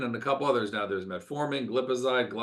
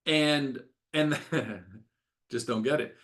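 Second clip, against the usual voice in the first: ordinary talk, nothing marked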